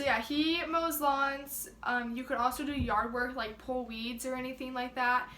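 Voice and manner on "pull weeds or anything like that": ordinary talk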